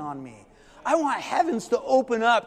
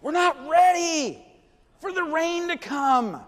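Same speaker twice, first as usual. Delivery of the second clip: higher, louder, slow mock alarm; mock panic; 9 semitones higher, 4 dB louder, slower than usual. Preacher mocking rain-shy Californians, a touch of mock alarm. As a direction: ham up the fear of rain.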